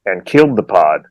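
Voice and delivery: normal talking voice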